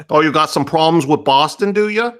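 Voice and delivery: hammed-up Boston accent; Boston tough guy. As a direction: taunt him like an offended Bostonian. Mock Boston confrontation.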